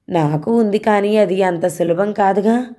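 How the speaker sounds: usual speaking voice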